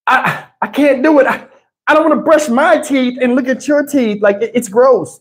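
high, whining wife voice; whiny mock wife. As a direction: whine it like a fussy wife. Wife's whiny refusal retold.

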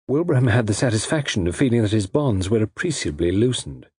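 ordinary delivery

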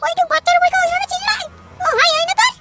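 very high-pitched cartoon mother, irritated; high put-on mother voice; scolding mother. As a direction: scold the boy in a squeaky, high mum voice. Skit mother scolds her son.

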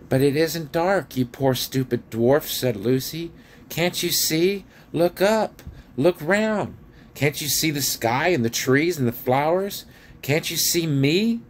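high, urgent pleading voice; pleading Lucy. A narrator's reading. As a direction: plead with them to look.

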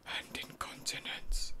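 slow breathy ASMR whisper. Acted. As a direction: whisper it close to the mic.

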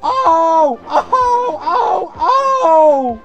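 high, loud shrieked ohs; storybook cries of alarm; cried-out ohs. A narrator's reading. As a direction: cry out oh after oh. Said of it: "they cried again".

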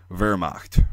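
Wehrmacht as VAIR-mahkt, slowly, American accent; V start on Wehrmacht with American vowels. Shown as a demonstration. Telling viewers it needn't be perfect, Wehrmacht slow with V. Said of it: "American, like, accent like that".

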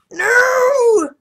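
sudden high cry of despair; anguished scream. Real, not acted. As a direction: wail it out in shock. Character dies in game.

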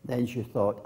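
ordinary talk

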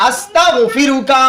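আস্তাগফিরুকা drawn out slowly; slow recitation. Shown as a demonstration. Teacher leading the dua: slow recitation. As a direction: recite each syllable slowly. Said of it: "teacher reciting prayer for repetition".

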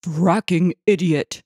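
slow, contemptuous insult; mild narrated shout. A narrator's reading. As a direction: draw out the insult in irritation. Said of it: "Roy shouted".

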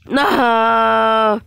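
loud high shout of dismay; real cry of dismay. Real, not acted. Gamer yelling at the screen as the obstacle hits. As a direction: yell as the run fails. Player about to fail obstacle.

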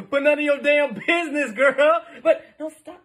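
laughing through the words. Real, not acted. Teasing partner about banana cakes, laughing. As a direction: crack up at your own comeback.

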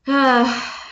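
long, weary sigh; group sigh. Acted. Friends sigh at Franklin's boast. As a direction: sigh out, unimpressed. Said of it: "Franklin's friends sighed".

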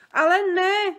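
high, loud cry of dismay. A narrator's reading. Sofie objects to the giant, high loud dismayed cry. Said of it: "zvolala Sofie".

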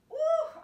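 high loud whoop after a hard set; high woo of effort. Real, not acted. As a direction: let out a high whoop of relief.

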